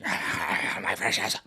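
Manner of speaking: very slow hissed Gollum voice; slow creepy Gollum impression. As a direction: stretch it out like Gollum. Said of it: "you're looking like Gollum".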